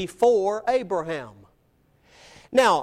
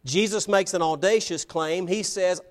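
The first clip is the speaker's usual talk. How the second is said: ordinary talk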